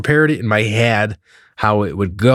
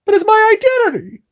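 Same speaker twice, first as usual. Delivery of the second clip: very high, squeaky mock-outraged voice; freaking-out Canadian; much faster than usual. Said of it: "Anybody who is Canadian here is just freaking out".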